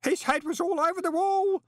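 very high, loud quavering wail; distraught, quavering boy's voice. Acted. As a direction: blurt it out in high-pitched panic. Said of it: "Stanley quavered".

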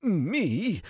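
high, wheezed disbelief; strained, high-pitched shock; wheezing. A narrator's reading. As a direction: squeeze it out after a coughing fit. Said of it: "Gil wheezed once he could talk".

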